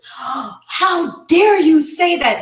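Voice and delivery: loud, high, drawn-out mock outrage; indignant scolding. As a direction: imitate a scandalised judge, stretching it out slowly. Acts out judge scolding her.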